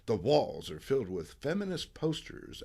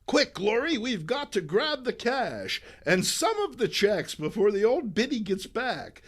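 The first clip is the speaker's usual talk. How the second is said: scheming villainess; 7 semitones higher than usual. Acted. Villain plotting.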